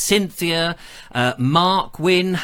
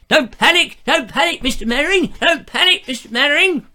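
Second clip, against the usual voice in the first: high, loud panicked shout; panicky Corporal Jones; 10 semitones higher, 4 dB louder than usual. Retold or imitated. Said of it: "just getting into the mood".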